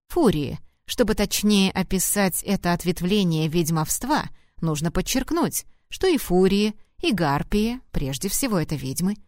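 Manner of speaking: usual speaking voice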